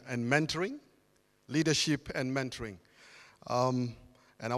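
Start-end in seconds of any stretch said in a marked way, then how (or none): none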